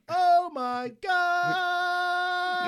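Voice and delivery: high, loud, long drawn-out held note; held note too long. Retold or imitated. Friend topping the cringe bit, held absurdly long note. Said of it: "Held that note a little too long there with a deadpan expression".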